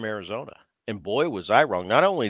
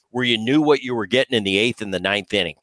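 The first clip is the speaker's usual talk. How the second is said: ordinary talk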